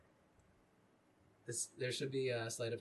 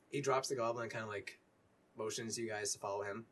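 usual speaking voice

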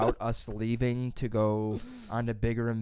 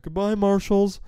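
loud, high farewell call; big drawn-out mock farewell; calling goodbye; 8 semitones higher, 9 dB louder, slower than usual. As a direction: call out to the stores as you pass. Calling farewells to passing stores.

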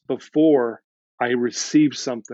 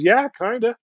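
high, rising, unsure yes; sheepish admission; 8 semitones higher than usual. Retold or imitated. Client admits her mistaken hope to counselor, mock-sheepish. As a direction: imitate her tentative agreement, pitched well up.

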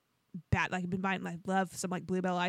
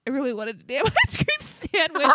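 louder, higher whine breaking into laughter; laughing mock complaint; 4 semitones higher, 5 dB louder than usual. Wife still wanting her ice cream sandwich, laughing complaint.

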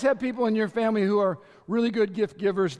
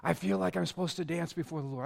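much softer, hushed earnest plea; soft earnest lady; 6 semitones lower, 8 dB quieter, faster than usual. Retold or imitated. Lady approaching pastor during worship, softer earnest request. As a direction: plead quietly with the pastor. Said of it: "I had a lady one time say".

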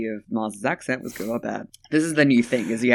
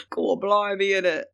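mock British accent; 7 semitones higher than usual. Mocking his British accent back.

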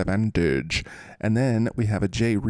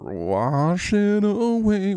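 playful sing-song; 10 semitones higher, much slower than usual. Real, not acted. Wordplay on player name.

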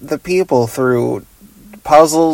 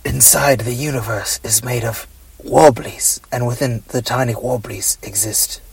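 hushed storyteller; 4 semitones lower, 8 dB quieter than usual. Pretend. Mock cosmic lore narration.

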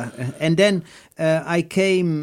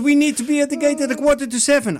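panicked; 10 semitones higher, 3 dB louder than usual. Retold or imitated. Guide panics about missing flight.

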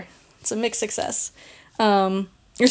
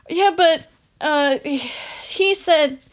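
a higher, halting, lightly voiced sheepish worker; sheepish excuse; 6 semitones higher, much slower than usual. Worker excusing himself to the supervisor, sheepish. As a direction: stammer out a weak excuse. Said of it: "and Frank is like".

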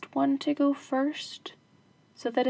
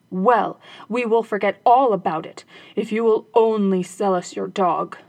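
louder robber voice; robbers' voice; 8 dB louder than usual. Acted. Robbers offering to buy the dog, bargaining.

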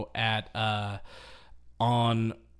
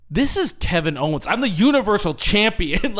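mock yell, building louder and higher; Kevin Owens; 6 semitones higher, 7 dB louder than usual. Champion yells at an unknowing caller, mock-bombastic shout. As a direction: boast loudly down the phone. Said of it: "yelling at a guy who doesn't know".

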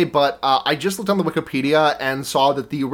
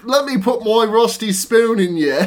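put-on Australian accent; 5 semitones higher, 3 dB louder than usual. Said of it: "you're kinda going Australian there".

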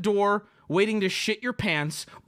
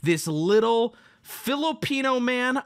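normal talking voice

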